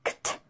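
Kt said fast and soft, the k and t run together; a soft, quick Kt; quick kt cluster. Shown as a demonstration. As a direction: snap out Kt quickly as one cluster. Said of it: "we have to pronounce them quickly".

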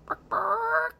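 loud, very high chicken squawk; chicken clucking. Shown as a demonstration. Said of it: "imitating a chicken".

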